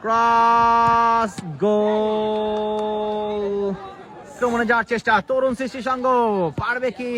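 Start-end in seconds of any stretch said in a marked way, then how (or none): 0.0-3.5 long drawn-out goal call; goal shout. Real, not acted. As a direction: stretch the goal call out loud. Goal called out in commentary.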